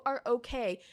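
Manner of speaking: normal talking voice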